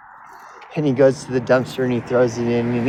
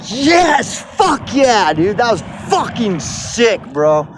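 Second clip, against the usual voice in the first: much higher shouted fan cheer; 8 semitones higher, slower than usual. Retold or imitated. Fan cheering at the TV as Michael Myers wins, excited shout. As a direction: whoop like your hero just won. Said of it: "And I'm just like".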